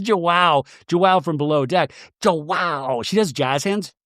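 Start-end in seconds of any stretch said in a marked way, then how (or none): none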